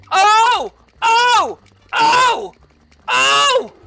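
loud, high yelps of "oh"; string of pained, high exclamations; repeated frustrated cries. Real, not acted. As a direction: cry out on each hit, separately. Repeated hits in the game.

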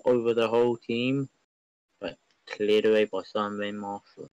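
normal talking voice

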